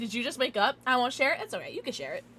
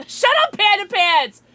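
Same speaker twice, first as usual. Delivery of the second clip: loud, high mock telling-off; playful yell; 9 semitones higher, 10 dB louder than usual. Streamer jokingly shushing a chatter, playful yell. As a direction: yell it with a laugh at the start.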